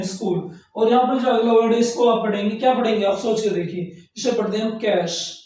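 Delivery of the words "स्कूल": स्कूल as "skool", sk not st; quiet, careful स्कूल with sk; correct pronunciation. Shown as a demonstration. Teacher settling the right reading of school. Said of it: "इसे स्कूल पढ़ते हैं".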